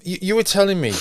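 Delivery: normal talking voice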